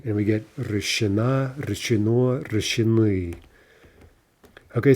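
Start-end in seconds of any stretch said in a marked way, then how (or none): 0.6-3.4 решена, решено, решены stressed on the last vowel; end stress. Shown as a demonstration. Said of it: "stress is always gonna jump to the ending".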